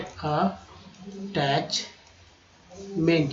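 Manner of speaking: extremely slow stretched word; slow demo. Shown as a demonstration. Teacher modelling the word, extremely drawn out. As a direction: drag every syllable out. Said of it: "teacher stretches word".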